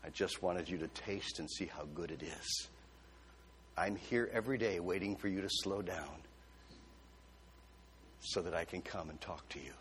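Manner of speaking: low, soft, tender voice of God; the Lord speaking tenderly. Retold or imitated. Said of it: "And He said".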